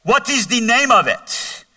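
high, slow, drawn-out mock yell; dad hollering through the bedroom door, acted; imitated yell from bed. Father calling from bed to his son in the hallway, imitated yell. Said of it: "he yells from his bed".

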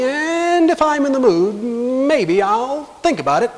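high, drawn-out singsong hedging; mock noncommittal. Preacher parodying what God doesn't say, mock noncommittal. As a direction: stretch it out as a teasing maybe. Mocks a noncommittal version of promise.

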